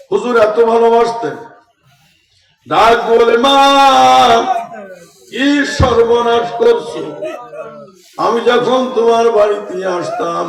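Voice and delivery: high, loud sermon cry; full-force preaching. Acted. Preacher recalls the Prophet's love for his grandsons, impassioned preaching.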